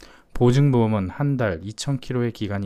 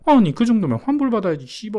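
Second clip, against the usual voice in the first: high, rapid indignant outburst; outraged protest; 11 semitones higher, 6 dB louder than usual. Acted. Cheated used-car buyer demanding a refund, outraged. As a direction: burst out like a cheated buyer, pitched way up.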